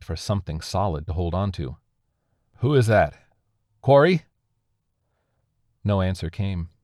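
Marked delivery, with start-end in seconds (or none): 2.6-3.2 raised, louder voice; calling out. A narrator's reading. Survivor questions an unseen glowing entity, calling out. 3.8-4.3 high, loud, rising name; questioning call. A narrator's reading. Survivor asks if the voice is his friend, questioning call.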